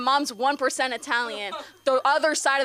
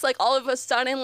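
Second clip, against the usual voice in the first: ordinary talk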